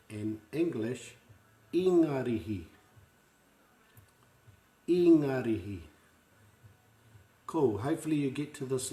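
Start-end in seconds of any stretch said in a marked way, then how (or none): 1.7-2.6 "Ingarihi" sounded out as ee-nga-ri-hi, clear vowels; Māori pronunciation. Shown as a demonstration. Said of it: "say it after me". 4.9-5.8 "Ingarihi" sounded out as ee-nga-ri-hi, clear vowels; Māori pronunciation. Shown as a demonstration. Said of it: "say it after me".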